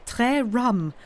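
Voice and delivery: put-on queer boyish voice. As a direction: mimic the husband's boyish quip lightly. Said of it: "in that queer, boyish voice".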